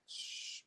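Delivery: soft voiceless shush. Real, not acted. Breaking off the story to shush someone nearby, softly shushing. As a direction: hush someone nearby with a quiet "shh".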